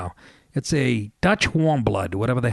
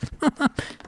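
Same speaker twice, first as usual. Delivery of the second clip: airy wheezing laugh, high up; high breathless laugh; 13 semitones higher than usual. Real, not acted. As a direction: let out a soundless high giggle. Laughing at wife's scolding.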